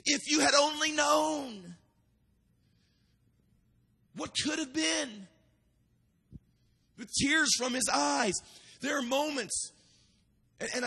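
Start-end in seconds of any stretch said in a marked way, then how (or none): 0.0-5.3 Jesus lamenting. Retold or imitated. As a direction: mourn over the city, half-crying. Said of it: "he looked at Jerusalem and he said".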